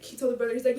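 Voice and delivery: normal talking voice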